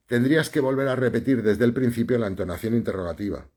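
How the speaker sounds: ordinary talk, nothing marked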